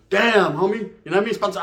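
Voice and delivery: ordinary delivery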